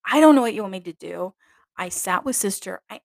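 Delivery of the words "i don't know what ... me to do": louder, faster angry reply; exasperated retort. Retold or imitated. Nun snapping back at her mistress, angry. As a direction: snap back, fed up. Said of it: "I'm getting mad".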